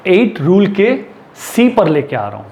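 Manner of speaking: usual speaking voice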